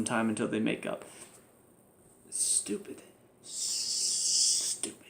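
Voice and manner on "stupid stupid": whispered, disgusted verdict; frustrated whisper. Real, not acted. Reviewer dismissing both storylines, frustrated whisper. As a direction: breathe it out in disgust.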